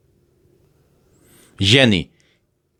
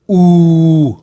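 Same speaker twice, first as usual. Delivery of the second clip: ऊ called out loud and high as a long 'oo'; emphatic vowel; 8 semitones higher, 8 dB louder than usual. Shown as a demonstration. Teacher loudly answering her own question ऊ. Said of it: "sound कौन सी हुई".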